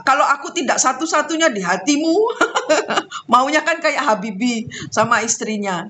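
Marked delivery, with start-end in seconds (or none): 2.2-3.3 a high, amused laugh. Real, not acted. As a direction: laugh at the absurd memory. Laughing at own story. (tawa geli)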